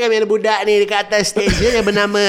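high, bright mall emcee announcing. Retold or imitated. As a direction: hype up the crowd like a mall MC. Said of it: "the M Cs at the Giant".

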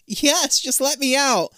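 high, desperate retold plea; exasperated mock pleading. Beth answers Amanda from inside the mirror, mock pleading. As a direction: beg impatiently to be released. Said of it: "hearing Beth scream from the other side".

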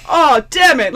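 high, loud mock frustration; pissed off. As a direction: curse like you're retelling your own annoyance. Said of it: "And I was pissed".

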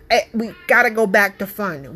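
normal talking voice

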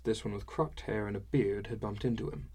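plain reading voice

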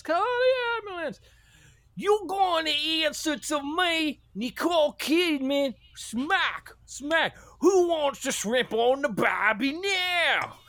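high-pitched, drawn-out hammy shout; over-the-top shrieky Aussie yelling; mock Australian outcry. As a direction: holler it slowly, way up high. Acting as Nicole Kidman, mock outcry.

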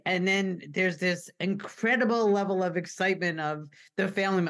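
ordinary delivery

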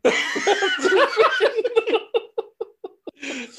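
high burst of laughter; loud laugh. Real, not acted. Big laugh.

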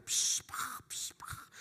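unvoiced pop and hiss like fireworks; mock firework hiss. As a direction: spit out a soft firework sound. Imitating fireworks at procession.

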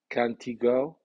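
"Can't he" linked as "can-tee", "he" unstressed; weak contracted pronoun. Shown as a demonstration. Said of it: "contrayéndose de la forma".

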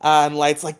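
ordinary talk, nothing marked

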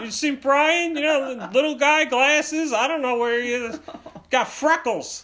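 searching dad. Acted. Dad asking strangers about his son, high mock-frantic questions. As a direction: ask around in a hammed-up dad voice. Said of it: "he's just asking strangers behind him".

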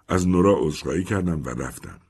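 ordinary delivery

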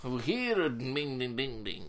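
hammed-up British villain firm; mock British. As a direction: greet clients like a scheming British company. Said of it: "sounds like an evil British organization".

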